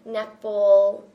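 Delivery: ordinary talk, nothing marked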